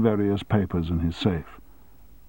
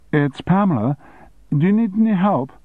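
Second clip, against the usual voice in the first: higher, eager offer of help; cheerful secretary; 10 semitones higher, 4 dB louder than usual. Acted. Secretary offering her boss help, eager.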